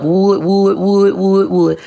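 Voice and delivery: droning mimicry of critics; mock broken-record chant; mocking repetition. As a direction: parrot the same word over and over, mockingly. Mimicking critics who keep repeating it.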